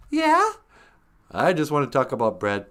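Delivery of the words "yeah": very high, loud questioning voice; high-pitched cartoonish reply; squeaky sidekick. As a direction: pipe up as a tiny sidekick. Sidekick voice answers host.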